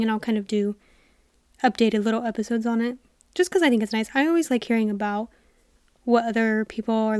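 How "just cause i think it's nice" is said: ordinary talk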